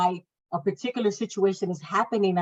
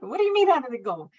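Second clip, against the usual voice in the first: quick, higher, indignant repeat; angry retort; 6 semitones higher, faster than usual. Retold or imitated. Mother repeating her angry question to the midwife, fast and raised. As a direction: fire the question back fast in anger.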